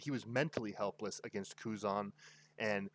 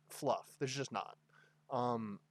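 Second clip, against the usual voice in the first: usual speaking voice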